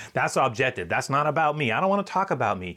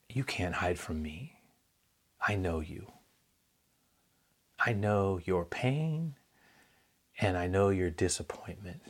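much softer, slow, nearly whispered; tender; 3 semitones lower, 6 dB quieter than usual. Acted. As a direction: lean in close and say it tenderly, almost a whisper. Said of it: "that was the moment where it was".

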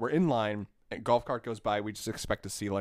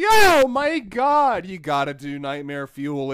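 gushing employee; 4 semitones higher, 8 dB louder, slower than usual. Retold or imitated. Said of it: "And she was like".

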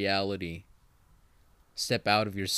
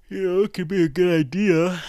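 words stretched through a big yawn; 9 semitones higher, 8 dB louder than usual. Real, not acted. Podcaster yawning mid-advice, just woken up, yawning through it. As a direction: talk right through a yawn. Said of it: "sorry, I yawned there".

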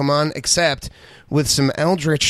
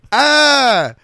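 high hammed-up shriek; mock scream; 11 semitones higher, 6 dB louder than usual. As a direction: shriek like a spooked actor.

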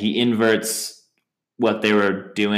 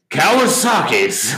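higher, louder, slow mock delivery; imitated film line; 9 semitones higher, 5 dB louder, slower than usual. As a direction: drag it out in a pitched-up voice.

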